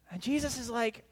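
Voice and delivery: ordinary delivery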